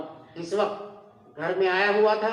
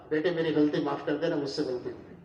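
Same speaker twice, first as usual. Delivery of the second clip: softer, lower plea for forgiveness; soft apology; 5 semitones lower, 7 dB quieter than usual. Retold or imitated. Father apologising to his grown son, softly and humbly. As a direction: ask your son's pardon gently.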